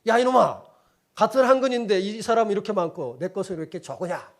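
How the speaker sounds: loud furious nobleman yelling at the butcher; angry shout. Retold or imitated. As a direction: shout him down in anger. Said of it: "화가 나서 소리 지르며 따졌어요". (화가 나서 소리 지르는 양반)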